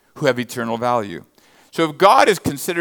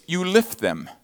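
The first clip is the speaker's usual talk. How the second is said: ordinary delivery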